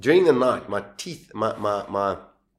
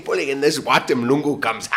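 normal talking voice

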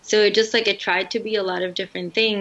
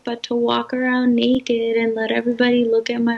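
mock naive girl voice; 5 semitones higher, 3 dB louder than usual. Mocking the naive heroine.

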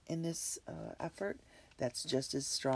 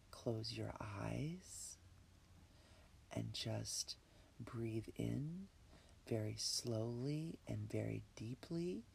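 breathy whisper, calm and unhurried; guided meditation; 6 dB quieter than usual. Acted. Leads a relaxation meditation.

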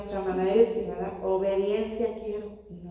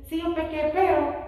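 a much higher, careless "sí, yo pequé, pero"; mocking Saul; 7 semitones higher than usual. Preacher mocking Saul's apology to Samuel, much higher. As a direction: sneer out Saul's cheap apology. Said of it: "acaba de decir".

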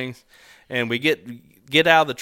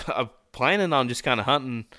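plain reading voice

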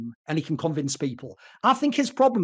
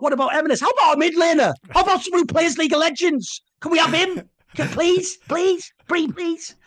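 higher, whiny, pleading voice; a hint of a coach whining for a player; mock desperate begging; 8 semitones higher than usual. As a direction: beg like a greedy kid asking for more. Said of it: "Jack from Cloud Nine".

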